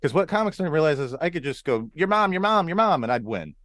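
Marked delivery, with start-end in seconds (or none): 1.9-3.0 high, louder repetitive jeer; mock taunting. Shown as a demonstration. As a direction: chant a cheap schoolyard comeback. Demonstrating a cheap comeback.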